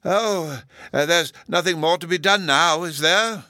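high, loud, hesitant academic; lifted, dithering character query; brightened-up professor. A narrator's reading. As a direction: perk up out of a daydream. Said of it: "suddenly brightened up again".

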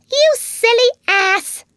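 tiny furious fairy squeal; very high, loud, slow squeaky outburst; Tinker Bell in a passion. A narrator's reading. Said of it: "cried Tinker Bell in a passion".

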